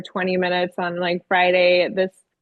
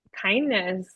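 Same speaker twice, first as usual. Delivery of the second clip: usual speaking voice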